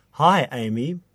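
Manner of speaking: normal talking voice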